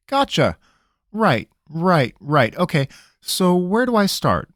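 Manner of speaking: a cornered trickster pleading; nervous country rogue. Acted. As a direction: squirm and stall, talking fast. Pickett voice again.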